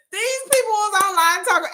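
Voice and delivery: ordinary talk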